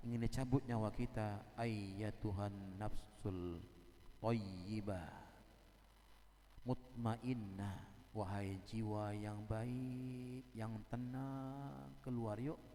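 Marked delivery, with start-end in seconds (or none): 1.6-12.6 slow, tender coaxing; gentle angel. Acted. Angel of death coaxing a good soul to leave, slow and tender. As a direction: softly invite the soul out, very slowly.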